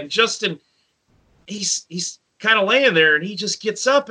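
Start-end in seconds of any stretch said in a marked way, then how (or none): none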